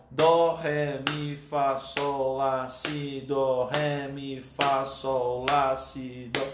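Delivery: steady, rhythmic note-name recitation; two notes per beat. Shown as a demonstration. Teacher showing the exercise at two notes per beat, even rhythmic chant. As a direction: recite the notes evenly, running on without long gaps. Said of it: "duas notas por tempo".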